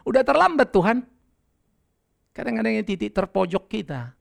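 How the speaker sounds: ordinary delivery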